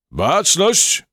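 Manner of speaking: firm command. A narrator's reading. Unseen voice orders the cockroaches to attention, stern shout. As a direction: snap them to attention. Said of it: "usłyszała stanowczy głos".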